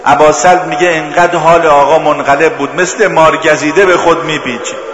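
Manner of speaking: loud grieving pulpit voice; sermon narration of the imam's death, loud; impassioned mourning narration. Acted. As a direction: tell the martyrdom with rising grief. Continues mourning narration at full force.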